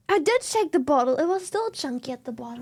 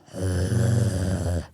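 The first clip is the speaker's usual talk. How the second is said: deep wordless growl; low throat rasp. Shown as a demonstration. Streamer showing how the voice is made, low growl. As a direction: let air rattle low in the throat. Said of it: "You just go".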